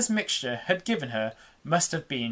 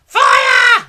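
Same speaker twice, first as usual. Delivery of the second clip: high, loud one-word yell; shouted alarm; 12 dB louder than usual. A narrator's reading. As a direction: scream the alarm. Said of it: "the old woman shouted".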